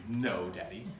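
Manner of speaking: low-pitched imitation of a child's attitude. Accused daughter answers her father, imitated attitude. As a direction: give Dad a denial with attitude. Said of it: "In that, in that attitude".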